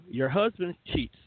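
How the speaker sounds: ordinary delivery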